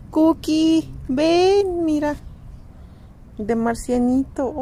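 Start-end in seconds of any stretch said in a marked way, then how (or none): 0.1-2.2 high, loud call to a pet; calling the dog. Real, not acted. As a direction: call the dog over brightly. Calling her dog over.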